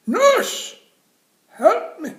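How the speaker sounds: very high, loud cry for the nurse; calling for help. Acted. Jim calling the nurse, very high and loud. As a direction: wail it down the corridor.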